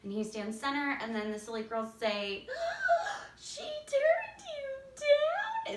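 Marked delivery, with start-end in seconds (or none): 2.5-3.4 very high shocked gasp; high sobbing gasp; distraught gasp. Shown as a demonstration. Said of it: "they're just so distraught". 3.4-5.8 very high, very slow mock wail; high drawn-out fake sob; hammed-up distraught. Shown as a demonstration. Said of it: "they're just so distraught, so emotional".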